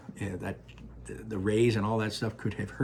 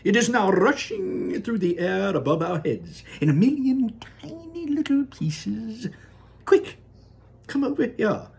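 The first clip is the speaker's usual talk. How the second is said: Wonka crying out; 13 semitones higher, 4 dB louder than usual. Acted. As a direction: sing it out with delight. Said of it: "cried Mister Wonka".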